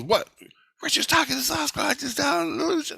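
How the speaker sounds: very high-pitched put-on voice; startled mock voice. Jesus reacts to news of Sasquatch, startled high put-on voice.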